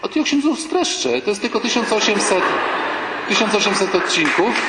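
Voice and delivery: mildly put-on eager lady voice; lightly voiced eager woman. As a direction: rattle it off like a proud soap fan. Woman summarises the soap.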